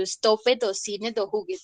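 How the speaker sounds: "stop it o sin it o juguet" read as spelt in Spanish; un-English extra syllable. Shown as a demonstration. Teacher showing what no gringo would say, Spanish-style endings. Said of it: "jamás ustedes van a escuchar a un gringo".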